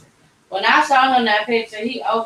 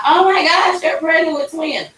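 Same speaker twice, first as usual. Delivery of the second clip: excited front desk; 8 semitones higher than usual. Retold or imitated. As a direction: gush it like thrilled receptionists. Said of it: "they was like".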